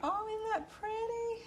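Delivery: high, slow, admiring coo; delighted gush. Real, not acted. Cook admiring her decorated cake, in a high delighted coo. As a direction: swoon over how pretty it looks.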